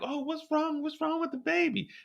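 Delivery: high, soft worried voice; worried woman. Acted. Mireille at work asking what's wrong, high and soft. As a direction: ask gently and high what happened. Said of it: "she's like".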